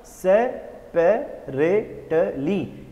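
"सेपरेटली" slowly, split syllable by syllable; slow teaching breakdown of "सेपरेटली"; separate syllables. Shown as a demonstration. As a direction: count out the syllables of "सेपरेटली" with small gaps. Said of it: "ये जो अलग-अलग शब्द आप देख रहे होगे".

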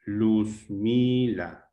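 "Luzmila" very slow, luz-mi-la with held syllables. Shown as a demonstration. Teacher searching for the stressed syllable, syllable by syllable. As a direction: stretch out each syllable of "Luzmila". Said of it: "A ver, tónica".

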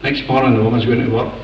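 usual speaking voice

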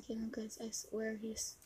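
hushed plotting to herself; conspiratorial whisper. Real, not acted. Impostor plotting under her breath, whispered. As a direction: whisper it so nobody hears. Secret scheming while playing.

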